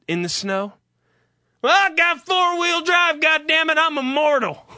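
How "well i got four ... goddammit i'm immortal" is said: high, hammed-up truck-owner voice; cocky pickup driver. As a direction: crow like an invincible pickup driver. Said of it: "the people in the fucking pickup trucks".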